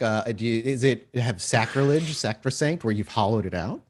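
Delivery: usual speaking voice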